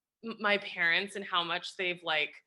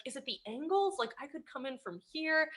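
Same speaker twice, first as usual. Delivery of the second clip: cautious DP; 3 semitones higher, 7 dB quieter than usual. Retold or imitated. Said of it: "the D P's like".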